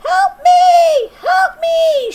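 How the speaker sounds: high squeaking cry for help; squeaky fly voice. A narrator's reading. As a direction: squeak like a trapped fly in a horror movie. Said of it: "she'd squeak like the fly with the human face".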